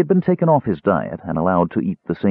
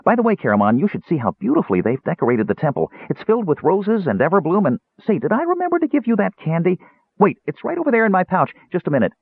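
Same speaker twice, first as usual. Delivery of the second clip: high-pitched, scatterbrained kender prattle; hurried chatter; 11 semitones higher, faster than usual. A narrator's reading. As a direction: run on eagerly from topic to topic. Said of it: "Tass hurried on".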